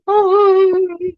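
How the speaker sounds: 울음을 흉내 낸 높고 큰 탄식; 백성들이 장막 앞에서 우는 소리를 흉내 내며 크게 헐떡임; mock wail. Imitates Israelites crying. (과장된 울음 흉내)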